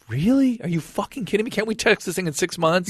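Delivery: pitched high, fed-up protest; as if he can't believe the doctor means it; exasperated disbelief. Retold or imitated. Retelling his reaction to the biopsy order, exasperated. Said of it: "I'm like".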